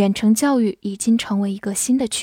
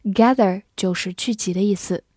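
normal talking voice

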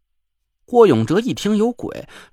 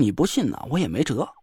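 softer, resigned thinking; inner thought; 4 semitones lower, 6 dB quieter than usual. A narrator's reading. Narrator thinking about the joker: soft inner voice. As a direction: think it to yourself. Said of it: "心想".

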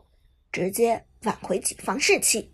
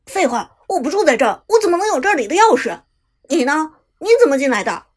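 loud, fast, aggressive challenge; 2 semitones higher, 8 dB louder, faster than usual. A narrator's reading. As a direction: bark at the intruder. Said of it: "气势汹汹地说". (气势汹汹地质问)

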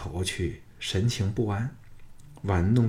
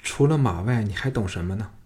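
plain reading voice

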